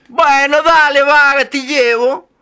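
high, loud teasing voice; Madre Nieve's voice. Acted. Madre Nieve agrees to take the girl home, high and loud. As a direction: tease her as you agree.

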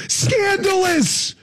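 high, drawn-out cry of fake shock; mock outrage. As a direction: stretch it out high, hugely scandalized.

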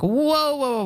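retold startled whoa, high then falling; high-pitched "hold on" reaction, re-enacted; startled interjection. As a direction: cut in to halt someone mid-reveal. Retelling her startled interruption of Willie.

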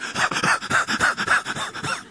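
voiceless panting bursts; quick rhythmic huffing, mimicking a frenzy; imitated frantic noise. Mimicking the prophets of Baal, frantic breathy noises. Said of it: "And they're all like".

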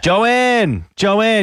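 high, drawn-out name call. Real, not acted. Host hailing the caller on the line, calling out. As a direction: sing out the name to get attention.

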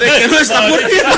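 loud, very high squeal of laughter; helpless high cackling through the words; laughing nonstop. Acted. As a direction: shriek it while cracking up. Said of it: "Y reía sin parar el doctor Colilla".

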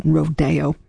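"rodeo" said ro-DAY-o; accent on second syllable. Shown as a demonstration. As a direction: put the stress on DAY in "rodeo". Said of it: "de Mille and Copland always said".

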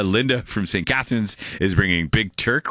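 ordinary delivery

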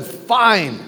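ordinary delivery

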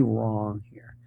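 hushed and grave; whisper. Real, not acted. Comic whispering to the audience that something is very wrong. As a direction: whisper it like a dark secret. Grave aside about homeless kids.

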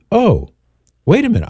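high, mock-puzzled interjection; feigned surprise. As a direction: act suddenly taken aback, pitched well up. Acting out a puzzled comeback.

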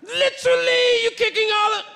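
high, strained mock British that slides into a Cosby voice; half-hearted Cosby impression; Bill Cosby-ish. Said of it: "sounds like Bill Cosby a little bit".

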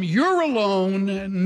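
ordinary talk

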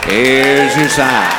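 very slow, low, dragged catchphrase; deadpan punchline. Acted. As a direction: stretch each word to milk the laugh.

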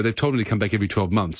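ordinary delivery

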